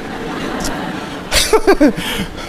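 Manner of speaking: soft, breathy laugh. Real, not acted. Preacher laughing after teasing the congregation, soft laugh. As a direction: laugh softly at your own tease.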